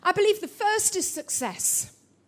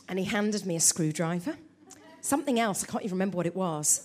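normal talking voice

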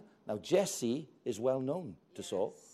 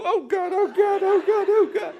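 high, loud panicked wailing; panicked soldiers; 12 dB louder than usual. Retold or imitated. Israel's soldiers fleeing Goliath, mock panic. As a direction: squeal in mock terror. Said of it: "they all go".

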